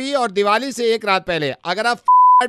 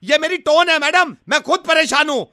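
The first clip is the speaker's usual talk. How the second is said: raised, heated pitch while apologizing; argumentative apology; 4 semitones higher than usual. Acted. Suitor blames his tone to the woman, argumentatively. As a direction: apologize as if in a quarrel. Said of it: "आराम से बात कीजिए".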